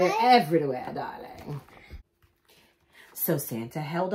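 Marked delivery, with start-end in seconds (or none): 0.0-1.6 a touch of posh wife. Acted. Mrs Claus voiced.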